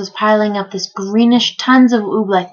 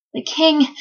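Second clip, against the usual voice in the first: higher, out-of-breath words; panting; 6 semitones higher than usual. A narrator's reading. Bartholomew breathless from running up the tower, panting. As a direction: pant it out after racing upstairs. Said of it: "panted Bartholomew".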